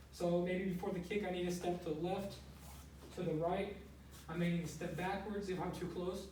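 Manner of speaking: usual speaking voice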